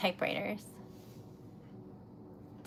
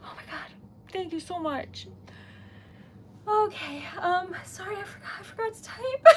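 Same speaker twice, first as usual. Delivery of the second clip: normal talking voice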